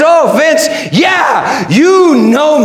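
high, exultant preacher's cry. Acted. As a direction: cry it out, drawing the last words out very long. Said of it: "And Jesus cries".

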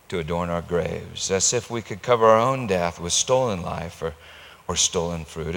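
normal talking voice

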